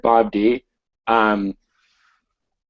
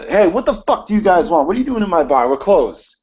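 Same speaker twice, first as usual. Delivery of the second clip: loud, high, angry bar manager; angry yell; 9 semitones higher, 5 dB louder than usual. Acted. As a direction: storm out yelling at the intruders. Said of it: "he's kinda storming out of the office".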